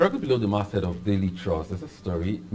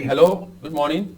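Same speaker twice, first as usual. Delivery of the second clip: to someone hard of hearing; 6 semitones higher, 5 dB louder than usual. Real, not acted. Host calls new caller.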